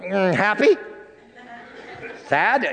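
a hint of a little kid; small boy's answers. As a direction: answer like a five-year-old, simple and sweet. Boy voiced answering feelings question.

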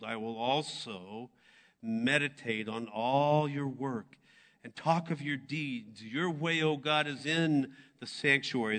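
measured, rising psalm reading; scripture reading. Acted.

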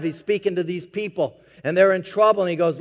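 ordinary talk

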